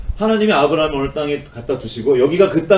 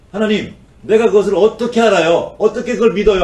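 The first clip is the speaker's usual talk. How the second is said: higher, louder doubting demand; demanding proof; 8 semitones higher, 7 dB louder than usual. Acted. Abraham demanding proof from God, loud and doubtful. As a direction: press God for proof, unconvinced. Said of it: "하나님께 증거를 요구합니다".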